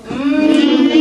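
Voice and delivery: Mm hummed loudly with lips closed. Shown as a demonstration. Teacher repeating the m sound loudly for the child. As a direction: hum Mm strongly through closed lips. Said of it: "Do it again".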